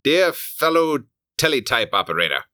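put-on high, pompous old-timey voice; Victorian gentleman. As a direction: read it like a Victorian telegram. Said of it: "Victorian era".

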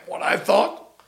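usual speaking voice

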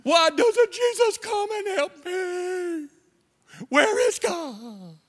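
high, slow mock wail to God; mock whine. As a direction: cry out like a self-pitying complainer. Whining complainer crying out.

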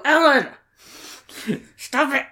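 high, loud mock reprimand; imitated scolding yell. Mom scolding dad for his joke, mock yelling. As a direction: yell it like an exasperated wife. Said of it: "glare at her and yell his name".

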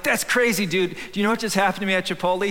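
high, fast excited retelling; a fast, high-pitched rush of excitement; excited friend. Retold or imitated. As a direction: blurt it out like big news. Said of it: "then Alex is like".

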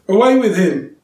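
the crowd yelling to take him away; yelled. A narrator's reading. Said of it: "they yelled".

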